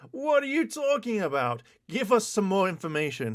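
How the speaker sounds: mock viewer voice. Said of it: "what you're asking".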